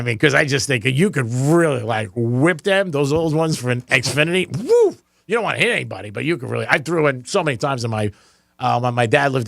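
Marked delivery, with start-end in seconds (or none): 4.5-4.9 high "woo" of something flung; mock whoosh. Host showing how he throws a remote, high whooshing noise. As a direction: imitate a remote whipping through the air. Imitates whipping a remote away.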